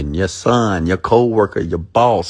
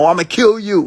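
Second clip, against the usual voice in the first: mock inner rage; 12 semitones higher, 3 dB louder than usual. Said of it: "The thoughts of you wanting to kill someone".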